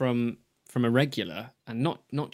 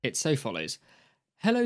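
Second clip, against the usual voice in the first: normal talking voice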